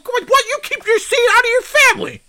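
high, squeaky mock retort; mocking squeal. Host squealing a mocking jab at the guest. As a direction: squeal the jab back at him.

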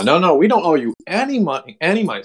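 acted-out indignant protest; protesting refusal. Acted quarrel over the bill.

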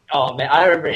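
ordinary talk, nothing marked

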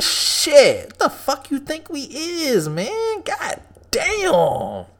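incredulous high-pitched brush-off; mock outraged refusal. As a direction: pitch climbing high in disbelief. Acted gym employee refusing membership.

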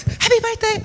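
high, perky birthday wish; chirpy greeting. Retold or imitated. Another staff member to birthday guest, chirpy. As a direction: pipe it out like an eager greeter.

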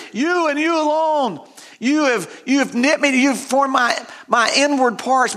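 high-pitched, fervent declaration; excited psalmist. Retold or imitated. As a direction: quote the psalmist with rising excitement. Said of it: "the psalmist is getting pretty excited".

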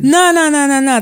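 high, loud, insistent denial; emphatic no. Real, not acted. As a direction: shut the idea down fast.

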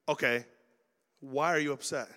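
normal talking voice

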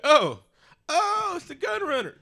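high, loud "oh" of surprise; sudden realization. Retold or imitated. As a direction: squeal the dawning recognition. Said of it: "And I was like".

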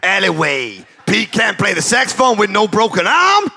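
put-on Deep South lawyer accent. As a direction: lay on a Deep South twang for the jury. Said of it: "in the style of a Deep South defense lawyer".

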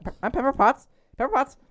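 high, mocking singsong of the name. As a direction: say the name like a silly cartoon. Mocking the name Pepper Potts.

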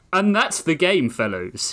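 a posh British ape winning at cribbage; British gentleman. Acted. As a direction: ape man gloating over his cribbage win, in a British accent. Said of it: "distinctly British voice".

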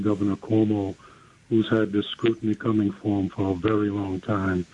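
ordinary talk

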